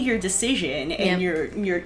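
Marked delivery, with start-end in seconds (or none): none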